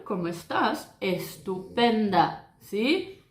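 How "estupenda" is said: Estupenda said slowly with closed Spanish e; slow Spanish demo. Shown as a demonstration. Teacher models Estupenda slowly in Spanish. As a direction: stretch Estupenda, closed e sounds. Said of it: "La letra E en español".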